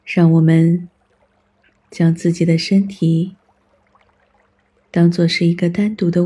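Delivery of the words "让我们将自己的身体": ordinary talk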